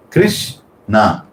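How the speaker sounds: "Krishna" broken into "Krish" and "na"; syllable split. Shown as a demonstration. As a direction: say "Krishna" in two parts with a pause. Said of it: "two syllables".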